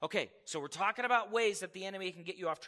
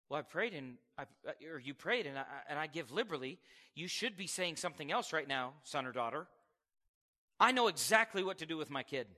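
lightly voiced God; 5 semitones lower, faster than usual. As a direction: gently correct a child. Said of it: "God's going".

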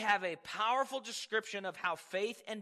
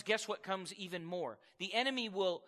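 usual speaking voice

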